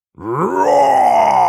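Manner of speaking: huge, long rising dino roar; loud dinosaur roar. Acted. Triceratops model coming to life, loud roar. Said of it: "begon heel hard te brullen".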